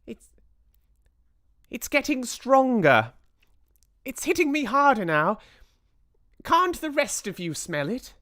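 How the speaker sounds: high, agitated witch squeal; Mildred crying out. A narrator's reading. Mildred asks the rest to smell it, high urgent squeal. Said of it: "cried the witch called Mildred".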